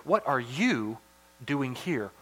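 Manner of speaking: soft question with "you" and "here" set apart; stress on YOU and HERE. Shown as a demonstration. God questioning Elijah, stress on "you" and "here". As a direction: lean hard on "you", then on "here". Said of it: "a big emphasis on you".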